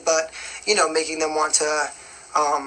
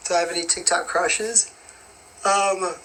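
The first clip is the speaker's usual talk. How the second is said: yawning. Real, not acted. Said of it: "I don't know why I keep yawning".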